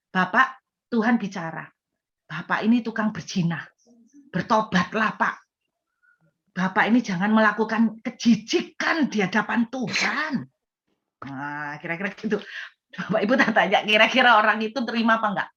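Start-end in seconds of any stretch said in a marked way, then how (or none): 0.1-10.3 stern, punched denunciation building in force; harsh accusing prophet. Acted. Prophet rebukes an adulterer to his face, harsh and accusing. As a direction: confront a sinner with blunt judgment. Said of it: "cara saya nyampaikan seperti ini ... dengan cara kasar".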